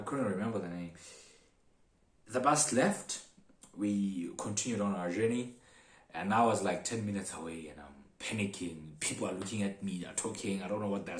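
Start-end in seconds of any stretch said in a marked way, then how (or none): none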